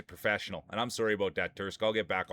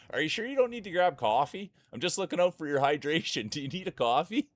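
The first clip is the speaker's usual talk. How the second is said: higher, sing-song fake concern; mock concerned nagging; 7 semitones higher, 3 dB louder than usual. Teasing Cheesy about grabbing coffee, mock concerned higher voice. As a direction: pester her sweetly about coffee. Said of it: "I just kept saying every five seconds".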